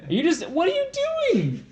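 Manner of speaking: high-pitched, incredulous complaint; exasperated protest. Real, not acted. Annoyed at co-host blocking the joke.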